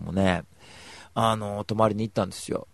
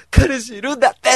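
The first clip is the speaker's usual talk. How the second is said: shocked wail; 14 semitones higher, 12 dB louder than usual. Pretend. Breaking news in a wail.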